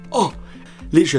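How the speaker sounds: ordinary delivery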